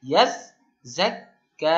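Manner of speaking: ইয়াজ্জাক্কা spoken very slowly, each doubled consonant held; drawn-out recitation. Shown as a demonstration. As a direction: stretch out ইয়াজ্জাক্কা syllable by syllable. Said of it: "এটা বলুন". (ইয়াজ্জাক্কা খুব ধীরে টেনে বলা)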